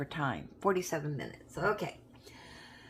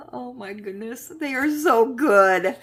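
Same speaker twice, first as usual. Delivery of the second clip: delighted; 7 semitones higher, 10 dB louder than usual. Real, not acted. Savoring chocolate, delighted.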